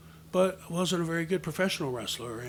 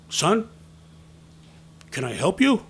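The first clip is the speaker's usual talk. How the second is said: raised, louder, pointed question; questioning a stray kid; 4 semitones higher, 7 dB louder than usual. Retold or imitated. Man taps boy on the shoulder, questioning firmly. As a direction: challenge the kid politely but firmly.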